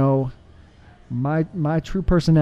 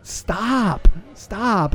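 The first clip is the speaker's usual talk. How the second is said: raised-pitch retold command to stop; firm intervention; 4 semitones higher, much slower than usual. Guest retells his buddy snapping him out of it, higher insistent command. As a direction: cut in firmly, one word at a time.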